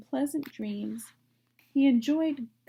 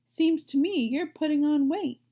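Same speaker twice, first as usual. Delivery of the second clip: put-on higher, louder old sheep; 5 semitones higher, 5 dB louder than usual. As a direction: tease like a nosy old sheep. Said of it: "she said".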